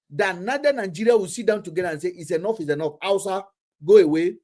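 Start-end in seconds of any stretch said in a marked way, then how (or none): none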